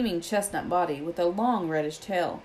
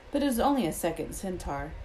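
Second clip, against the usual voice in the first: plain reading voice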